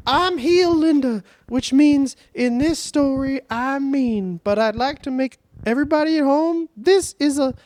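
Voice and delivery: high, slow mock old-woman voice; old woman Linda. Host mocking Linda McMahon as a theatrical old woman, high and slow. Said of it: "an old woman who just goes like".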